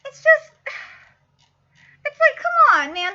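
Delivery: high, loud, whining cry; mock whine. Real, not acted. Fan whining at the student's blunder, mock crying. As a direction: whimper in mock despair. Despairing over character's mistake.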